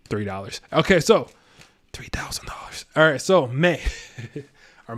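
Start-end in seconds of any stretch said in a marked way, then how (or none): 1.9-2.9 whispered aside; sly whispered correction. Real, not acted. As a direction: breathe it out under your breath. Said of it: "whispered joke aside".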